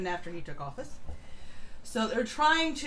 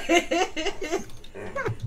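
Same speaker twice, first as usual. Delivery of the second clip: hearty laugh; 7 semitones higher than usual. Real, not acted. Host laughs at co-host's sagging joke.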